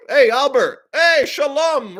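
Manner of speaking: loud, high hail; calling out. Acted. American calling Einstein.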